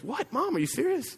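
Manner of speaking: high-pitched startled disbelief; shocked question. Retold or imitated. Son shocked at his mother's scheme, high and startled. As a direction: squeak it out like a stunned son. Jacob reacts to mom's trick plan.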